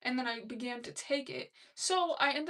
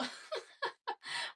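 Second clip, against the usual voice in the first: breathy laugh; 13 semitones higher, 5 dB quieter than usual. Real, not acted. Laughs at her own smile.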